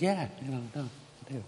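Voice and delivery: soft, fading mumble of an answer; subdued reply. Retold or imitated. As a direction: answer quietly, trailing away. Peter's sheepish answer to Jesus, retold.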